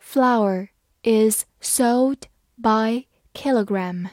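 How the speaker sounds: slow, careful read-aloud; each word drawn out evenly. Shown as a demonstration. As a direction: read it slowly so learners can follow. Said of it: "好，慢慢来读".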